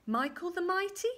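high, loud rising question; hopeful guess. Acted. Queen makes another guess, questioning. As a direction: raise the name as a question.

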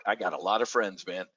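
ordinary delivery

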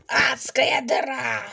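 slow hushed whisper; ominous whisper. Acted. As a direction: breathe it like a curse.

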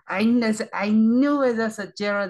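high, loud I-knew-it cry; excited fan exclaiming; triumphant. Real, not acted. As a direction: crow with triumph. Fan reacting to reveal.

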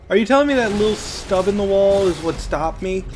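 ordinary talk

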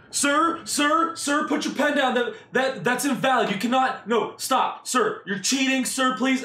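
high, loud frantic yelling; mock shouting. Friend of rival bidder shouting him down, high frantic yelling. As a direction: shriek at him to stop. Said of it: "he starts shouting".

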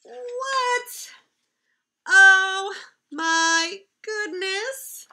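high, slow, drawn-out oh my goodness; mock astonishment. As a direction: gasp at how silly that was.

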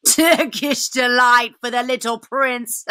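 slow, mocking dwarf voice; sneering dwarf. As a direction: jeer at him like a nasty dwarf. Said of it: "He grinned in a repulsive manner".

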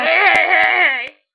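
loud repeated hey; calling out. Acted. Stopping the squabbling friends, loud call. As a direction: shout over everyone to get attention.

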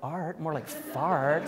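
put-on slow, giggling childish jab; laughing mock taunt. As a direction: deliver the bad pun while cracking up. Mocking joke about artists.

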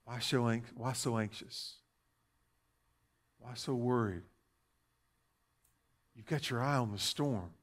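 gently calm an anxious believer; tender divine reassurance. Acted. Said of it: "speaks to us and says".

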